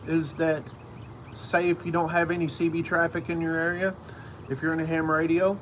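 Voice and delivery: ordinary talk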